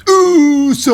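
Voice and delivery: high, loud, sustained call; drawn-out entrance shout. Acted. Host heralding Jey Uso's arrival, long high shout. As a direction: holler it out like an entrance hook.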